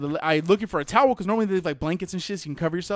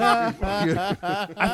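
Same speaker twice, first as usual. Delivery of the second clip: laughing. Real, not acted. Laughing at own story.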